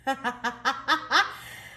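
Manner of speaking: a short rising giggle; sheepish laugh. Real, not acted. Reader laughing after apologising for her outburst, sheepish giggle. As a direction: laugh it off, a bit embarrassed.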